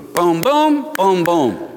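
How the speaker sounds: punchy mimicked burst of creation; imitated boom. Preacher sounds out creation happening, punchy imitation.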